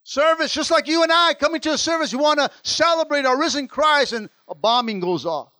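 high, loud preaching climb; preaching at full force. Acted. Pastor tells the church of the bombing, high and loud. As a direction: raise the congregation with a shout.

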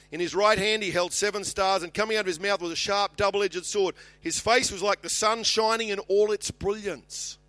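high, loud, driving Bible reading; Scripture proclamation. Acted. Preacher reads John's vision of Christ's face, proclaiming loudly. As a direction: proclaim each image boldly.

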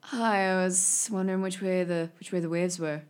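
laid-back beach bum druid; surfer dude voice. Acted. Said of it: "a little bit of David".

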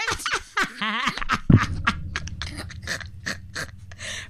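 wheezing laugh; long breathy laugh. Real, not acted.

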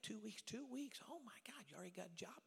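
retold amazement, kept soft and high; mock disbelief. Bishop learning the preacher is back in town, mock disbelief.

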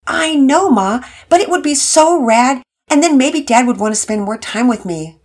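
put-on a hopeful kid pleading with his mom; teen son.

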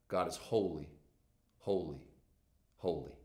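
low, soft, spaced-out solemnity; hushed reverence. Real, not acted. Preacher telling the church God is holy, hushed and reverent. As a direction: let each "holy" drop quietly into a pause. Solemn threefold repetition for emphasis.

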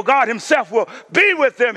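ordinary talk

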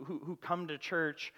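ordinary talk, nothing marked

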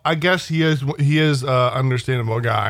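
ordinary delivery

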